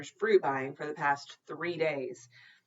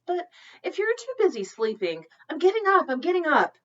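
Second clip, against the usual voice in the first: much higher and louder, hurried; hurried squeak; 11 semitones higher, 10 dB louder than usual. Acted. Jen scrambling out of bed, high and loud.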